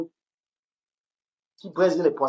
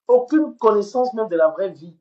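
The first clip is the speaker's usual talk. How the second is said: loud, higher, hammering aucune; emphatic outburst; 6 semitones higher, 7 dB louder than usual. Real, not acted. Ranting at a rival streamer, loud and emphatic. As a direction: slam every word home. Streamer berating a woman online.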